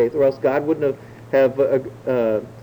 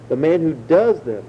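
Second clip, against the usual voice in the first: DOES stretched and leaned on; stress on DOES. Shown as a demonstration. Preacher repeats the verse for emphasis, stress on DOES. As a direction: lean into "does" as the key word. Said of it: "emphasis on that word".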